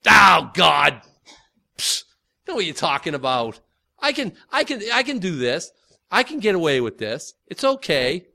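loud scoffing shout, then casual self-assurance; mocking God. Sinner mocking God, a loud scoffing shout. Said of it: "You're mocking God".

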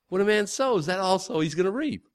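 normal talking voice

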